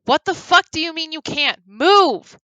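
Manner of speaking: loud furious shout at Grum; angry yell. Acted. Bree yelling at Grum to get out of the way. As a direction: shove him aside with a yell.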